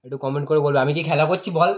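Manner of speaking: loud frustrated pleading; exasperated loud. Real, not acted. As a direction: shout it out, fed up. Frustrated editor shouting at viewers.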